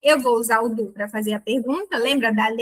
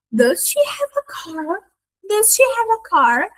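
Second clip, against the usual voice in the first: "Does she have a car?" pitched higher, with a big rise on car; exaggerated intonation; 8 semitones higher, slower than usual. Shown as a demonstration. Teacher shows students how to overdo the tune, pitched high. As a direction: ham up the rising question like a drama scene. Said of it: "uma entonação até exagerada".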